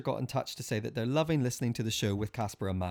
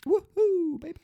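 excited; 5 dB louder than usual. Real, not acted. Cheering for the weekend.

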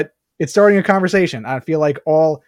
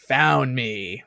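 lightly imitated demon, lower and softer; creepy demon voice; 4 semitones lower, 6 dB quieter than usual. Imitating the demon revealing itself in the hospital room, lightly creepy. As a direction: drop low and soft, a hint of creepy. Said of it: "with that, like, creepy voice".

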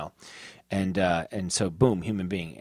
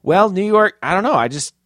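higher, louder flustered explaining; flustered defence; 9 semitones higher, 9 dB louder than usual. Real, not acted. Friend questions the guess, flustered high and loud explaining. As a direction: scramble to justify the guess.

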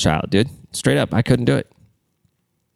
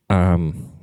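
trembling voice; 3 semitones lower than usual. Real, not acted. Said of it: "my voice just trembled there".

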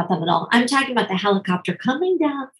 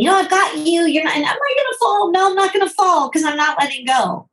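anxious parent; 9 semitones higher, 4 dB louder than usual. Retold or imitated. Parent clinging to child's bike.

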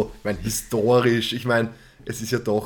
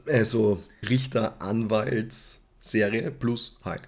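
ordinary talk, nothing marked

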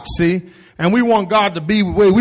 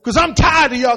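fed-up Jesus; exasperated command; 6 semitones higher than usual. Shown as a demonstration. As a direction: bark it like a tired coach. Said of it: "exactly how he did it".

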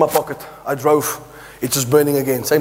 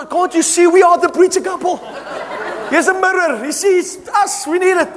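exasperated pleading; 10 semitones higher than usual. Retold or imitated. Husband pleading with his wife to keep the money, high and exasperated. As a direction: make her see it's obviously us.

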